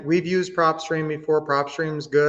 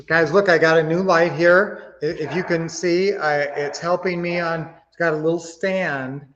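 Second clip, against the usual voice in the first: normal talking voice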